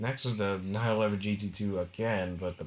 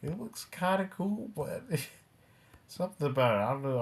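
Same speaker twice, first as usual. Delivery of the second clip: higher, giggly voice; real laughter interrupting the sentence; laughing; 5 semitones higher than usual. Real, not acted. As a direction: try to get the words out through giggles. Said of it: "I'm laughing because something happened just now".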